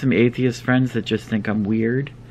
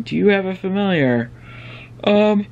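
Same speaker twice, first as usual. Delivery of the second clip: talking through a yawn; 8 semitones higher than usual. Real, not acted. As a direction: yawn mid-question. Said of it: "I keep yawning".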